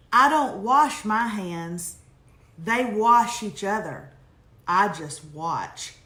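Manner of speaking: slow, firm line-by-line delivery, falling at the end; deliberate quote reading. Acted. Reading a listener's emailed line to the audience, slow and deliberate. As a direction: read each sentence out deliberately, with pauses. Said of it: "I saw this line the other day".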